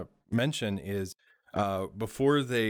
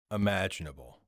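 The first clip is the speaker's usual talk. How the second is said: ordinary delivery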